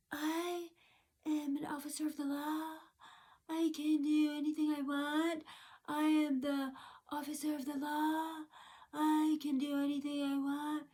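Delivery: high, soft, droning self-important officer; mocking dim officer. Retelling the officer claiming to be the law, mocking. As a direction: mimic her in a quiet monotone, pitched high. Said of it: "She goes".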